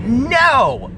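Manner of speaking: normal talking voice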